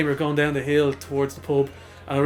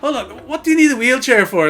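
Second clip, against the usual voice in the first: baffled dad; 9 semitones higher, 5 dB louder than usual. Retold or imitated.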